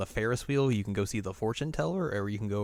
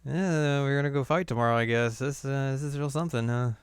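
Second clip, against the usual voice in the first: higher put-on voice; retold character voice; 5 semitones higher, 3 dB louder than usual. Podcaster voicing Claire before tomorrow's fight, lightly put on. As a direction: lightly voice her in a raised pitch.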